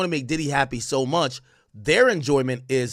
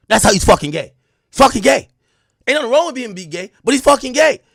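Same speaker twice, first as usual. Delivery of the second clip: loud, higher-pitched retort in a retold argument; emphatic insistence; 8 semitones higher, 8 dB louder than usual. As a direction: insist at rising volume, as if nobody gets it.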